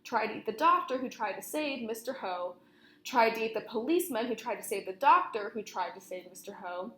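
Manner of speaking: usual speaking voice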